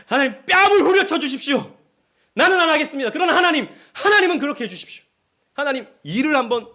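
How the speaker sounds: loud and high, pleading God to strike the enemy; mock vengeful prayer. Pastor acts vengeful prayer, shouting.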